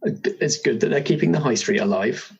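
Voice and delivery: ordinary talk, nothing marked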